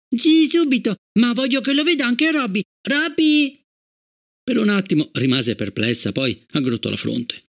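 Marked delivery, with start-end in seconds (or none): 0.1-3.6 put-on high, eager child voice; a small girl keen to show her new toy; little girl's voice. A narrator's reading. Gloria to her parents, eager little girl's voice.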